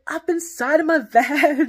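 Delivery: high, loud drawn-out yell; retold yell. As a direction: blurt it out loudly, stretching the words. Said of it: "just, like, yells it out".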